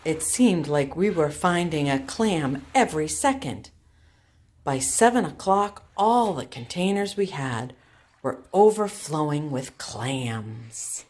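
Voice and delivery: put-on bright, clear storybook narration for kids; story reading. Teacher reading a clam story to her class, storytelling voice. Reads a picture book aloud.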